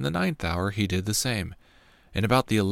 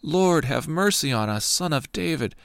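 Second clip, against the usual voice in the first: raised, higher plea for mercy; crying out; 6 semitones higher than usual. A narrator's reading. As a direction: call out to him from the roadside. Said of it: "they cried out".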